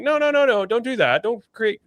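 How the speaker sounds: high-pitched panicked no no no; alarmed pleading. Retold or imitated. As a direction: beg them to stop, voice way up. Acted warning to tech makers.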